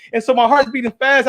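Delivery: ordinary talk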